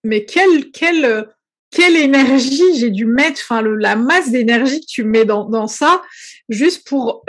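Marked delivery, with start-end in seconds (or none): none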